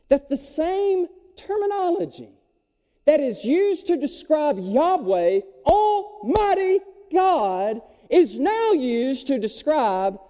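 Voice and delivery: "Almighty God" high and drawn out; slow, high-pitched, weighty stress on "Almighty God"; emphatic climax. Real, not acted. Preacher hammers climactic point.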